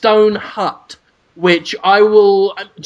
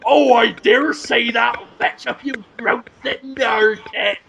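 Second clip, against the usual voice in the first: higher, put-on rogue's voice; grave robber's partner; 5 semitones higher than usual. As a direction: play a hammed-up old-time crook. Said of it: "you can turn around and go".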